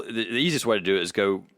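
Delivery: ordinary talk, nothing marked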